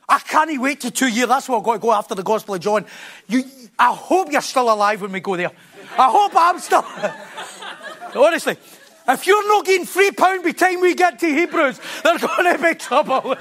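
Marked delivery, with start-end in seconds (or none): 6.0-13.4 laughing teasing warning to the congregation. Real, not acted. Preacher teasing the congregation about Hebrews, laughing. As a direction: chuckle through the joke.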